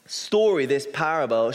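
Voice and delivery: normal talking voice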